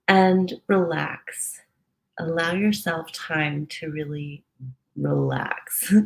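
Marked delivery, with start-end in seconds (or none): 0.1-1.6 slow drawn-out relax. Shown as a demonstration. Guided relaxation cue. 4.9-5.9 whispered relax. Shown as a demonstration.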